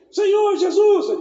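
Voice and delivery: high, loud, slow desperate cry; blind man crying out. Retold or imitated. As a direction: call out to Jesus desperately. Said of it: "você tá gritando".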